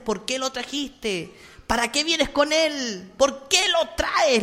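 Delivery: put-on the girl's demon voice at the priest; demon's scream. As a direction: shriek it as the possessed girl, accusing. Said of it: "le gritaba".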